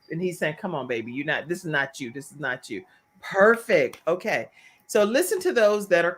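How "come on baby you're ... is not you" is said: low, soft husband voice; gentle coaxing. Retold or imitated. Imitating husband calming his wife, gently coaxing. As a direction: lower and softer, coaxing a loved one.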